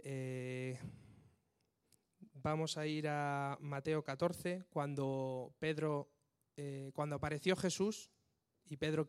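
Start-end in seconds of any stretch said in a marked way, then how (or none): none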